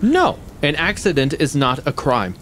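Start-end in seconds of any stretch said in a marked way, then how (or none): 0.0-0.4 a sudden high, loud "No"; sharp indignant denial. Acted. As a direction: snap the denial out, offended. Denying a crime sharply.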